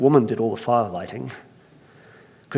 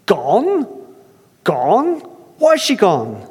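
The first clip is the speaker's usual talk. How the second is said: higher, louder Irish disbelief; incredulous Irish; 7 semitones higher, 3 dB louder, much slower than usual. Acted. Earthmaker astonished, Irish accent. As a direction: repeat it in astonishment. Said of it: "I can't help but try it".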